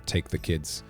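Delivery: usual speaking voice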